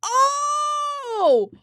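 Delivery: long, high, loud "oh"; shocked cry. Real, not acted. Shocked reaction while watching.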